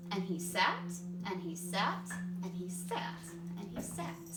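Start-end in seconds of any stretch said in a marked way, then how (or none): none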